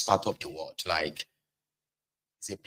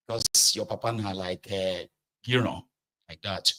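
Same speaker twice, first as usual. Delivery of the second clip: usual speaking voice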